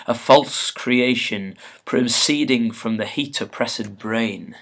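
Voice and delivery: loud opening, slow declaimed Shakespeare; stately soliloquy delivery, drawn-out phrases; dramatic verse reading. Acted. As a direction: recite it slowly as if addressing a vision. Reads Macbeth's speech aloud.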